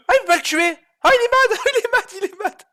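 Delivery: very high, squealing gamer outburst; high-pitched rant. Real, not acted. Streamer to opponent mid-game, high-pitched ranting.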